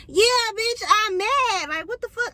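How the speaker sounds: high, loud, exasperated mock retort; indignant comeback. Acted angry comeback to being questioned.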